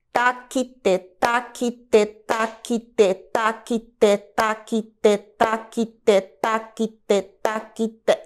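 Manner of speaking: metronomic "taquite", three even syllables per beat; evenly chopped rhythmic syllables on "taquite"; three equal parts. Shown as a demonstration. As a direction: recite "taquite" as steady triplets on one pitch. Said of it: "Eu dividi o meu pulso em três partes iguais".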